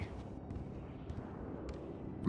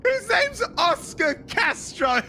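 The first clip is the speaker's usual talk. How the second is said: excited shout; 14 semitones higher, slower than usual. Real, not acted. Laughing shout at the name.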